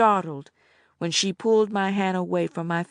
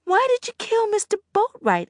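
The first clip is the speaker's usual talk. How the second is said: put-on much higher girl's voice, aghast; gasping question. A narrator's reading. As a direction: ask in horrified disbelief. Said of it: "I gasped".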